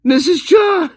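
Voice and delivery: high, full-throated shout of the name. Real, not acted. Gamer screams the name at the game character. Calling out louder again.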